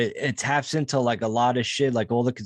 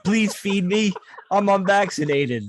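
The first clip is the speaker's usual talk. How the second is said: high pleading beggar voice; hammed-up begging plea for food; mock begging; 7 semitones higher than usual. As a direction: whine it like a starving beggar. Begging unvaccinated person imitated.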